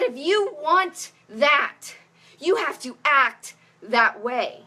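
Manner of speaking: slow, deliberate quoting; slow quote. Retold or imitated. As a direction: spell it out slowly, word by word. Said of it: "But she said".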